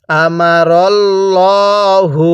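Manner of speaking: মা drawn out three alifs in আমারাল্লাহু; Quranic recitation. Shown as a demonstration. Teacher showing the long vowel, slow recitation. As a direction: hold the মা long, recite slowly. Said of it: "ভেঙে ভেঙে পড়ব".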